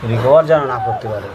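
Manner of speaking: cruel laugh. Acted. Torturer laughing over the wound.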